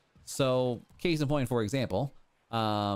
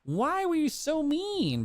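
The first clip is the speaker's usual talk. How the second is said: whiny; 11 semitones higher than usual. Real, not acted. Slow high whine.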